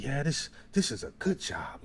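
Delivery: put-on hushed, voiceless old lady's words; whispered quote. Imitating the old coworker to the host, whispered.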